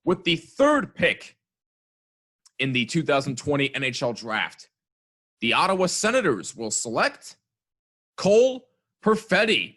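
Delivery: draft announcer. Acted. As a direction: announce the pick like the commissioner. Mock draft pick announcement.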